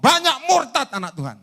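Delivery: high, loud pulpit cry; thundering proclamation. Retold or imitated. Preacher relaying God's warning of apostasy, loud and fervent. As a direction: proclaim it with full force. Preaching climax quoting the Lord.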